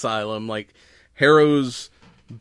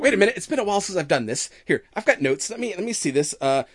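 put-on higher, bubbly Valley Girl goddess; Valley Girl accent; 7 semitones higher than usual. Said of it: "almost Valley Girl accent".

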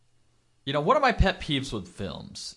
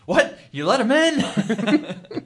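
loud, high, incredulous outburst; mock shocked; 11 semitones higher, 8 dB louder, slower than usual. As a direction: act out drawn-out disbelief, laughing. Retelling Eddie's shocked reaction.

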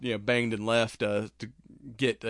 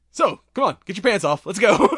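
much higher and louder, gleefully pushy; cheerful urging with sinister glee; eager creepy doctor; 9 semitones higher, 10 dB louder than usual. Retold or imitated. Torturer telling his captive to strip, gleeful and loud. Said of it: "so nice that it's fucking creepy".

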